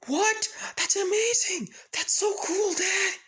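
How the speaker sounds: very high, loud, bursting excitement; thrilled squeal. Acted. Izuku shouting to his dad about the trip, thrilled and shrill. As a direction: shriek it like the best news ever.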